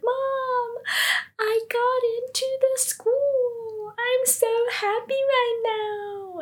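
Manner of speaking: very high, drawn-out mock squeal; hammed-up overjoyed daughter, slow and sky-high; ecstatic. Daughter phoning mom about school, mock ecstatic. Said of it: "She is ecstatic".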